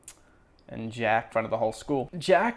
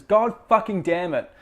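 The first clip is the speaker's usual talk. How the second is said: repeated curse, still raised and loud; a touch less heated mock rage; angry outburst; 6 semitones higher, 4 dB louder than usual. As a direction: quote the swear with mock fury. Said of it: "He says".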